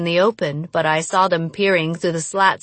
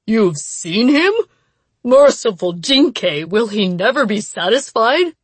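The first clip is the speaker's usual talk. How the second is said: horrified; 3 semitones higher, 4 dB louder than usual. Acted. Headman horrified by news.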